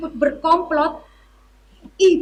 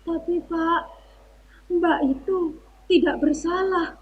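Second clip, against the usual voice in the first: timid; 8 dB quieter than usual. Acted. Timid plea to the officer.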